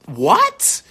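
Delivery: high, loud disbelieving what; surprised what. Real, not acted. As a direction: squeal it in disbelief. Shocked at turning thirty.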